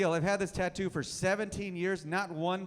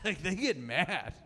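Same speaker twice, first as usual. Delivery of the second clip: ordinary talk, nothing marked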